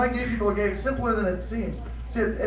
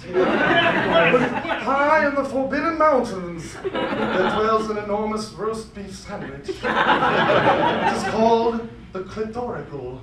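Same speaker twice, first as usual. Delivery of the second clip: epic legend narration; 5 dB louder than usual. Acted. Epic quest narration parody.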